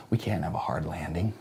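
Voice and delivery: ordinary talk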